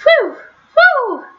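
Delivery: high whooshing wind sound; wind whistle. Acted. Wind blowing through night trees, high whoosh. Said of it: "it was a wind".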